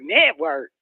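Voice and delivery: drops low, echoing the word in disbelief; low scornful echo; incredulous. Real, not acted. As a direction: repeat it like it's absurd.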